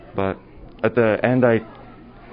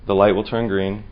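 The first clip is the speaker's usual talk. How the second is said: deliberate delivery with long dramatic pauses; slam poetry recital. Acted. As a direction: open forcefully, then let the phrases settle. Student recites slam poem.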